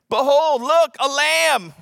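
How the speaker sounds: Behold, look, a lamb, slow and loud; shouting. Retold or imitated. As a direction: call out, pointing. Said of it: "John shouting to crowd".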